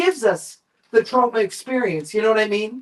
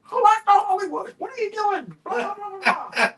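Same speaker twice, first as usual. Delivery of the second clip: high mock rant at the crew guy; mocking Lloyd's yelling; 11 semitones higher than usual. Director berating the crew guy, high mock rant. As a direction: squeal it like a ranting boss. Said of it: "He's like".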